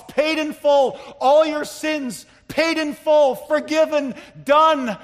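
high, loud proclamation in punched phrases; crying out. Retold or imitated. Preacher voicing Christ's cry from the cross, loud crying out. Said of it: "he cries out from the cross".

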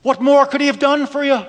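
high, fast, pleading Irish mother; lightly voiced Irish mother pressing her point; broken Irish accent. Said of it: "in her broken Irish accent".

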